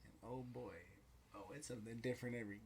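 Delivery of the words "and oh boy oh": ordinary talk, nothing marked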